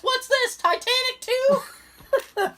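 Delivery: high, slow mocking call-out; mocking shout. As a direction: tease the screen, drawn out. Friend shouts at screen.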